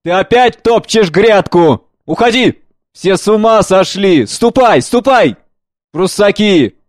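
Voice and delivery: put-on loud, slow, raging old man; in a rage. Old gardener yelling at the boy, furious. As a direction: chase him off the bed, furious. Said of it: "В ярости".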